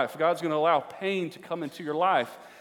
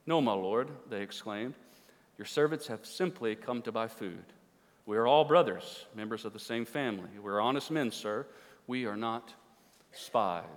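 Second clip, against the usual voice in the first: low, soft, measured Bible reading; hushed, low storytelling from the pulpit; scripture reading; 9 semitones lower, 7 dB quieter than usual. A narrator's reading. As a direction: read the passage out quietly and soberly. Reads scripture aloud.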